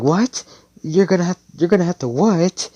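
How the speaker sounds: high, loud, incredulous squawk; shocked disbelief. Acted. Noelle learns she will be carried, shocked. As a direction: act appalled, pitch leaping right up.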